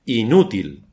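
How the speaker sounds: "Inútil" with a firm accent on Ú; stress on the U. Shown as a demonstration. Preacher repeats the verdict "Inútil", stress on Ú. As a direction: lean on the middle syllable of "Inútil". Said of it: "con acento en la U".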